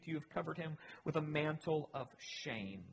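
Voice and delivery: ordinary talk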